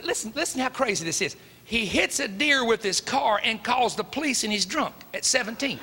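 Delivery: ordinary talk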